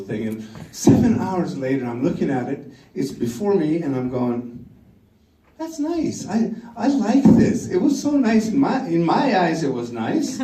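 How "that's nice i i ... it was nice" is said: self-satisfied delight. Retold or imitated. Admiring his own painting.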